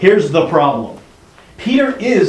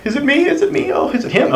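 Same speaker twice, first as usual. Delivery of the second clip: much higher, startled questions; panic, each question higher; anxious disbelief; 14 semitones higher than usual. Retold or imitated. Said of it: "And they're all like".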